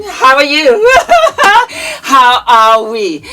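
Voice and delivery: high, loud greeting with a laugh; greeting fans, high and laughing; excited greeting. Real, not acted. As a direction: squeal hello to your fans.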